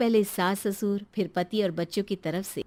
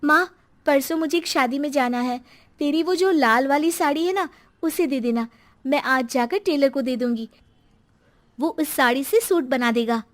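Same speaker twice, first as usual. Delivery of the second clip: put-on young daughter's eager request, a bit higher; pushy daughter; 5 semitones higher, 6 dB louder than usual. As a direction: ask for the sari, casual and demanding. बेटी माँ से साड़ी माँगती है. (माँ से ज़िद करती बेटी)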